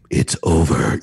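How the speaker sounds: low, loud monster rumble; deep, drawn-out movie-villain menace; Batman voice. Shown as a demonstration. Podcast guest showing the unstable rumble of added bass, Batman voice. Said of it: "the Batman voice, you know, Venom".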